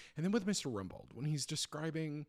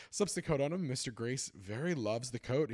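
normal talking voice

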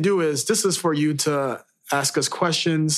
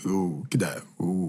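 much lower, softer, seductive purr; sexy deep voice; 8 semitones lower, 5 dB quieter than usual. Acted. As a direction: drop into a sultry bass. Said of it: "we'll work on our sexy, deep voices".

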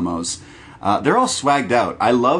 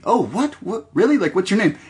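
surprised fan; 9 semitones higher than usual. Retold or imitated. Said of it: "it's like".

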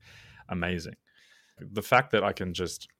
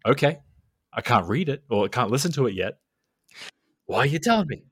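mock exasperation; 5 semitones higher, 4 dB louder than usual. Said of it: "I'm like".

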